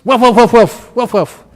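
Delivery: loud, high barking woofs, mimicking a dog; monk imitating a Bali street dog barking at him; imitated dog barking. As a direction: bark like an angry street dog, full voice. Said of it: "past the dogs in Bali".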